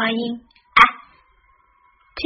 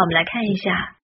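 ordinary delivery

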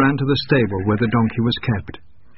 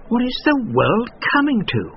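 higher, tutting merchant; disapproving merchant; 12 semitones higher than usual. A narrator's reading. Merchant gossiping about the miller, disapproving. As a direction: shake your head as you say it. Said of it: "the miller heard one of them say".

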